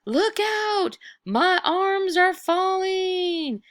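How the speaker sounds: high, slow, drawn-out ghost wail; ghostly wail. Acted. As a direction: wail it down the chimney like a lost spirit. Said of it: "the voice wailed down the chimney".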